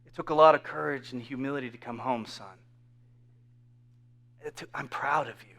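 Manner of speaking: low, hushed, tender reassurance; whispering father. Acted. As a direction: speak softly and intimately to a returning son. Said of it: "the Father's voice is whispering".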